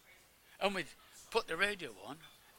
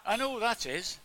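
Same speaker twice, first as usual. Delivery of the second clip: retold call, higher and louder; calling from another room; 6 semitones higher, 6 dB louder than usual. Sick friend calls to visitors in the porch, calling out. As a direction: call it through from the next room. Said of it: "a voice from another room said".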